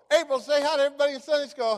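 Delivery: high, loud, rapid instruction; prompting the caller. Retold or imitated. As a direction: re-enact briskly telling a caller to greet everyone.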